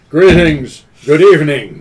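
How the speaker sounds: booming, projected from the diaphragm; grand rhythmic bard, projected. Acted. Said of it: "I engage bard voice".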